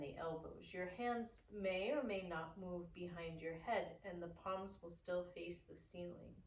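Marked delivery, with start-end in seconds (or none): none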